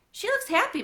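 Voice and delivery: high, bright-eyed teacher line, a touch playful; teacher's pleased voice. Acted. Teacher Mister Grayson cheerfully tells Bat, high and pleased. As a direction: voice the kind teacher, lifted up high.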